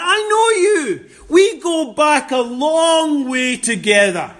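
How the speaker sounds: building, rising accusation from Satan; Satan's menacing boast. Retold or imitated. Preacher voicing Satan to sinners, taunting and rising. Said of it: "And Satan says".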